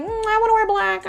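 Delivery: high-pitched whiny imitation; mock whine.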